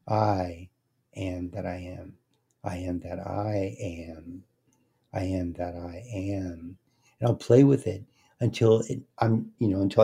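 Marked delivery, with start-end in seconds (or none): 0.1-2.1 long first "I", pause, the rest very soft; stress on first "I". Shown as a demonstration. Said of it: "I'll emphasize a word". 2.6-6.8 slow, hushed, second "I" drawn out; stress on a word. Shown as a demonstration. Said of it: "I'll emphasize a word".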